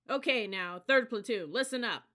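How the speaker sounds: loud, high call for attention; yelled call. A narrator's reading. Cadet leader addressing his platoon, loud yell. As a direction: shout to get their ears.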